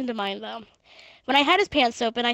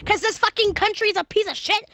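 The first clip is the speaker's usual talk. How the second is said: pitched-up angry rant; angry outburst; 9 semitones higher, 4 dB louder than usual. Real, not acted. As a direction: vent at the country. Angry rant about the country.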